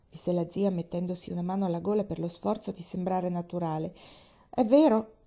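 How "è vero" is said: bright, higher and louder, smiling through it; smiling exclamation. A narrator's reading. Pollyanna to her aunt, who calls her sweetheart: smiling, higher, louder. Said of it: "esclamò Pollyanna sorridendo".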